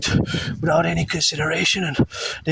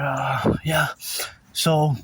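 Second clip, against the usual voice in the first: usual speaking voice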